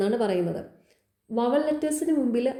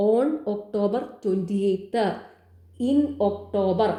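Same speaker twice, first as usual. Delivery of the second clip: slow example reading; much slower than usual. Shown as a demonstration. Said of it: "slowly reads out examples".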